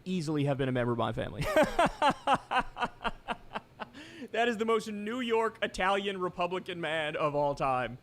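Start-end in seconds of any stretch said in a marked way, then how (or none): 1.5-3.6 1 real laugh. Real, not acted. Laughs at family joke.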